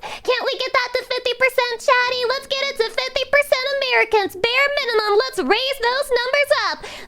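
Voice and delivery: high, coaxing push to chat; rallying chat. Real, not acted. Streamer rallying chat to raise American viewers, high. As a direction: cheer the viewers on.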